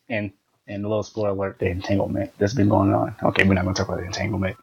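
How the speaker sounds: hushed half-whisper. Real, not acted. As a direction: drop to a secretive hush. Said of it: "Why are you whispering again?".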